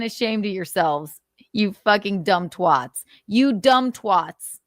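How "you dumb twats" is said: each word dragged out slowly and hit hard; slow emphatic insult. Real, not acted. Repeating the insult to critics, slow and deliberate. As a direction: spell out "You dumb twats" slowly, with weight on every word.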